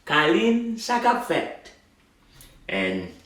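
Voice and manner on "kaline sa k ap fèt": acted greeting. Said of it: "Marie asked her".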